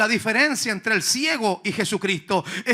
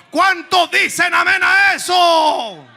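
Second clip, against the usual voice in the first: "¿Cuánto dicen amén a eso?" called out higher, slow and drawn out; stretched shouted amen call; 8 semitones higher, 9 dB louder, slower than usual. Real, not acted. Preacher calls for amen, slow high shout. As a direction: rouse the congregation with a long, high shout.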